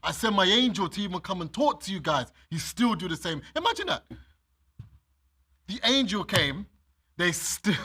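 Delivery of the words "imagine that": incredulous. Real, not acted. Incredulous aside.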